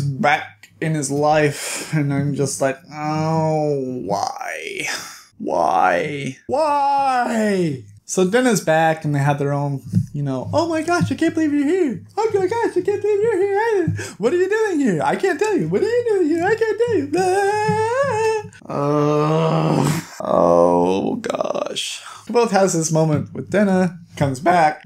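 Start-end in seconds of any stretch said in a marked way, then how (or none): 2.9-7.8 mock despair. Reader reacting to Denna's return, hammed-up dismay. 10.5-18.5 very high-pitched squealing parody; mock gushing. Two old friends meeting by surprise, mocking excited squeals. Mimics gushing reunion with Denna. 18.7-22.0 exasperated. Real, not acted. Exasperated after mocking the reunion.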